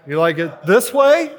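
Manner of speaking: mock eye doctor, loud. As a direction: playfully ask like an optometrist. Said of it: "It's like at the eye doctor".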